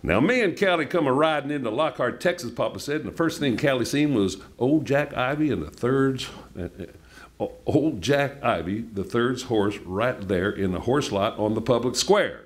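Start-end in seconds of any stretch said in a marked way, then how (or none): 0.1-9.4 folksy Texan storytelling voice; story narration. A narrator's reading. Author reads novel to audience with Texan lilt. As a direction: tell it like an old-timer yarn.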